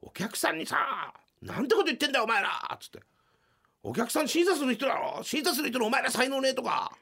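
high, loud imitation of a raging producer; furious producer. Producer scolding the comedians in the hallway, imitated fury. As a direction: tear into them like an angry boss. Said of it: "めちゃくちゃキレてんの".

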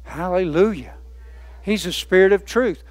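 ordinary talk

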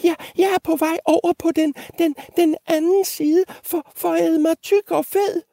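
very high, stuttering little goat; tiny squeaky voice. Acted. Littlest goat explaining himself to the troll, tiny high stutter. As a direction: squeak and stumble over the words. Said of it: "med sin lille bitte stemme".

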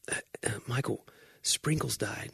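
hushed, breathy whisper. Retold or imitated. Coworkers quietly telling the boss the cat died, whispered. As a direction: whisper the bad news gently. Coworkers tell Michael bad news.

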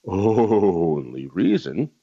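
"only" stretched very long and low; drawn-out only. Real, not acted. Talk host repeating for emphasis, "only" heavily drawn out. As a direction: drag out "only" slowly before "reason". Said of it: "let me say it again".

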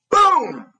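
high, loud shouted boom. Retold or imitated. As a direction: shout it like a door crashing. Door kicked in.

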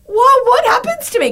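high, squeaky alarmed question; a hammed-up shriek of dread; mock panic. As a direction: pitch it way up in mock horror. Panicked question about menopause.

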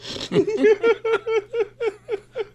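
high burst of real laughter; laughing fit. Real, not acted. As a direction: break into helpless laughter. Teasing tasty dishes remark.

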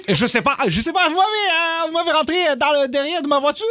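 put-on high-pitched, flustered Haitian-accented driver. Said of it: "jaser avec un accent haïtien ou en créole". (accent haïtien aigu, conducteur affolé)

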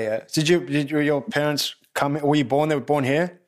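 ordinary talk

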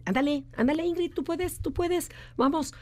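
high, eager pep-talk cheer; rally her on like a coach at the finish line; hyped-up high cheering, pushing a friend to go for it; cheering encouragement. Retold or imitated. Eight cheering someone on.

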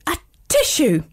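high-pitched mock sneeze; a sudden storybook sneeze; acted sneeze. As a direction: sneeze it out as a spoken "atishoo". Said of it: "everyone in the classroom was sneezing".

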